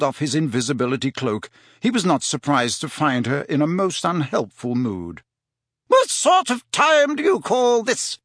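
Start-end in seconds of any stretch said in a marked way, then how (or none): 5.9-8.2 high, slow, indignant scolding; irritated fat lady. Acted. Fat lady to Harry coming back late, indignant. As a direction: scold him in a high, put-on lady's voice.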